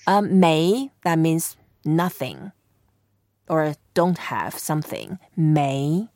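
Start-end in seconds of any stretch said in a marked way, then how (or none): 5.4-6.1 没 in a rising second tone. Shown as a demonstration. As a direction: say 没 with the pitch climbing. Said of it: "It's second tone".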